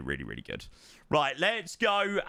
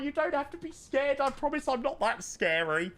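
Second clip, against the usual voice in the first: mock reassuring reply; 10 semitones higher than usual. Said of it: "I was like".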